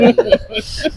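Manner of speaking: high-pitched giggle; light, high laughter; laughing. Real, not acted. Laughs off the artist joke.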